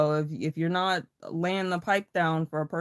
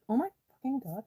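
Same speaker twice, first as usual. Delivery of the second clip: higher excited exclaim; 8 semitones higher, much faster than usual. Real, not acted. Awed by the tea.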